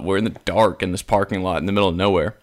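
usual speaking voice

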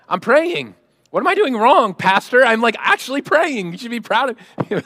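loud, high-pitched self-justifying protest; mock indignant protest. Congregant protesting to the pastor about praying, mock indignant.

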